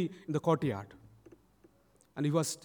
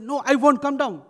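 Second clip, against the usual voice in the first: put-on high, loud defiant boy; adamant refusal; 9 semitones higher, 11 dB louder than usual. Boy up a tree refusing his pleading mother, adamant. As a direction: shout the refusal back stubbornly. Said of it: "He was so adamant".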